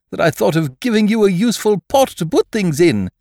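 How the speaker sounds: high, loud, pleased bear voice; happy Pooh. A narrator's reading. Pooh glad he gave a useful pot, happy. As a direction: declare it with high-pitched pride. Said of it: "said Pooh happily".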